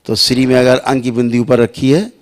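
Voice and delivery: ordinary delivery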